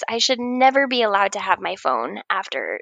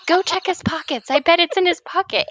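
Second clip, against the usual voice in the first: higher-pitched mock insistence; retold demand; 6 semitones higher than usual. Patient sending nurse to find the suspect, mock demanding. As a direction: act out an indignant patient's order. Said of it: "demanding her to go find him".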